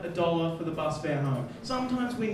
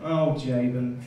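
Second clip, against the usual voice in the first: lightly voiced mother, much lower; mother's voice; 7 semitones lower, slower than usual. Mother answers her son Jabin, in a lightly acted low voice.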